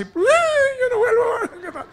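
high, slow drawn-out wail; hammed-up sob; wailing bride. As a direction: stretch the cry as she's carried off. Bride leaving in the taxi.

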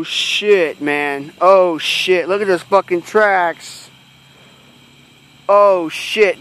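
ordinary delivery

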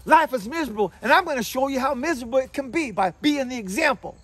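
high, loud overdramatic complaint; mock miserable declaration. Mimicking a person acting miserable as example, loud mock defiance. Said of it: "be the person that says".

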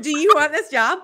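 amused, laughing delivery. Real, not acted. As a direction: joke it out while cracking up. Joking question amid shared laughter.

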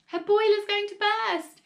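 high, loud alarm; frightened, high-pitched shout; panicked wail. A narrator's reading. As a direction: cry out a frantic warning. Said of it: "Missus Cranky wailed".

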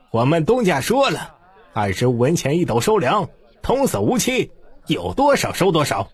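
calling out loud. A narrator's reading. Shop clerk announcing prices to the crowd, calling out. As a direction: call the price out to the whole street. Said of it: "随即高举着手大声道".

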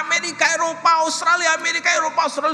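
high, loud angry chant; furious yelling. Retold or imitated. Preacher reliving his rage in the car, furious shout. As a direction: re-enact a raging outburst at God. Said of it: "saya dalam keadaan marah luar biasa".

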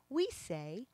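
ordinary talk

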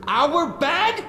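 outraged disbelief. Acted. Spider-Man furious at Venom's casual apology. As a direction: blow up at the absurd apology. Repeating the villain's flippant apology.

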